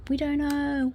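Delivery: soft, high sing-song on a stretched "we"; playful sing-song. Pretend. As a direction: lilt it softly and high. Playful repeated refrain, building.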